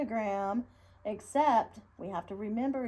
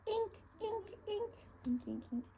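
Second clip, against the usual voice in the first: ink quill squeak; 12 semitones higher, 8 dB quieter than usual. Shown as a demonstration. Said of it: "little ink quill".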